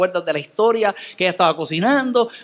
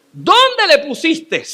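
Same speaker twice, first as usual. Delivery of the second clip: calling out; 8 semitones higher, 5 dB louder, much slower than usual. Acted. Jesus asks where Lazarus lies.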